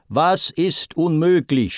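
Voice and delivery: mild storyteller's shout; shouted question. A narrator's reading. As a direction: yell it across at them. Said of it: "schrie Poseidon ihnen zu".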